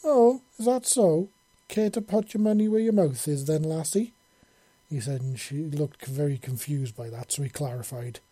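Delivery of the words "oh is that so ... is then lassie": lightly voiced cocky old man; challenging old Scot. Monty challenges Yelena.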